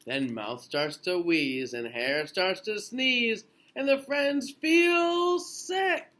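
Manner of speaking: lilting storybook read-aloud; gentle bedtime picture-book reading; reading to children. A narrator's reading. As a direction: read it slowly to little ones.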